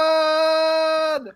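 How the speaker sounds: long high held Wilson shout; drawn-out mock cry of Wilson; Castaway-style cry. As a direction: call out Wilson like a lost castaway. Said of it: "We got Yogi Bear going".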